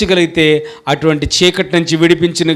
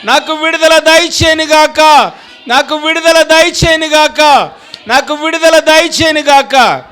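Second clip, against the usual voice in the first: higher, louder fervent declaration, slowing on the key lines; preaching at full force; 11 semitones higher, 5 dB louder than usual. Acted. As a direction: proclaim deliverance over the crowd with all your breath. (పూర్తి శక్తితో ఉత్సాహంగా ప్రసంగం)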